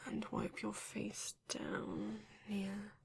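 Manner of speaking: put-on slow and soft, voiceless, as if tending a child; hushed care while wiping dirt away; tender whisper. Vampire cleaning the intruder's face in a soft whisper.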